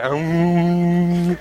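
a lightly imitated little mm of pleasure; child's happy mm. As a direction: hum a contented mm after each bite. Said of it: "Take another bite".

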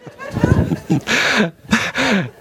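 breaks up laughing at his own story; wheezy burst of real laughter. Real, not acted.

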